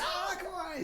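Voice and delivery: very high, soft imitation of her delight; retold excited greeting. Woman hugging visitor, retold excited greeting. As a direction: squeal it softly, imitating her welcome.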